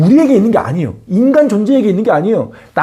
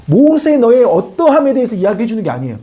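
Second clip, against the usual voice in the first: ordinary talk, nothing marked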